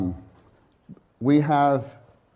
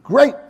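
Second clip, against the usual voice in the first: "Great" cried high and loud; stress on great; 12 semitones higher, 10 dB louder than usual. Shown as a demonstration. Preacher repeating his emphasis, "Great" loud and high. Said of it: "Did you see how I did that?".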